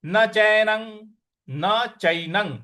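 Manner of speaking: चैनं ending in a nasal ang, slowly; pronunciation demo. Shown as a demonstration. Teacher shows students the anusvara of चैनं sounding as ang. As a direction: close चैनं on ang, drawn out. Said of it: "न पर जो अनुस्वार है उसका उच्चारण हमें अंग".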